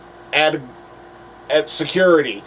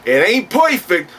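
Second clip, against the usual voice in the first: higher-pitched, emphatic alter-ego reviewer; Earth Two counterpart; 4 semitones higher than usual. Acted. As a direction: play the cocky alternate self, pitched up. Plays his Earth Two counterpart.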